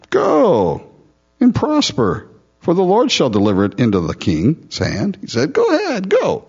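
mock-cheerful, high sarcastic send-off. Prophet mocking the king with sarcastic high cheer. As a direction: sneer it out as fake encouragement. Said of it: "He's being sarcastic".

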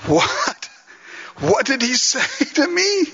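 high, amazed double take; stunned disbelief. Acted. A believer stunned by Jesus's words, high amazed. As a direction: gasp it out in wonder.

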